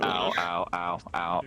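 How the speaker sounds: pained ows from bruises; sore and bruised. Real, not acted. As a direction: wince through each ow. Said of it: "So I'm a bit sore".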